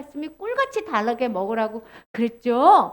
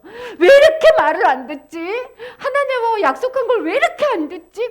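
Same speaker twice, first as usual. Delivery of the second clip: mildly exasperated acted complaint, raised voice; angry; 7 semitones higher, 5 dB louder than usual. Ezekiel angry at the disobedient people, raised complaint. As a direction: scold with hammed-up frustration. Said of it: "에스겔의 마음도 화가 났어".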